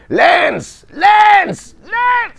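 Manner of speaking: high, loud repeated shout of a name; retold battlefield shout. Voice actor recalling a war-film scene, hurling the name. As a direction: bellow the name across a battlefield. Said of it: "mais hurler quoi".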